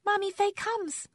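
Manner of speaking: put-on high, slow little-child voice; a tiny excited toddler calling out; high toddler squeal. A narrator's reading.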